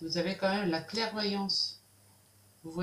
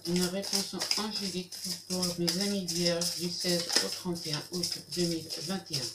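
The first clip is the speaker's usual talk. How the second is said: solemn invocation. Acted. Invokes spirit guides for answer.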